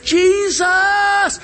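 high, drawn-out desperate scream; hollering for help; screamed cry. Acted. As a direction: scream the name out. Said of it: "He screamed".

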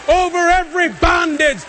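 high-pitched victory proclamation; full-force preaching. Acted. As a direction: drive each phrase home to the congregation.

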